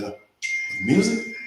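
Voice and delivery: slow questioning echo, rising at the end; doubtful surprise. Retold or imitated. Doubtful echo of friend's suggestion.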